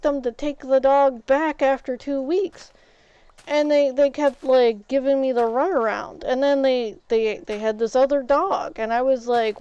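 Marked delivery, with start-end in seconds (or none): none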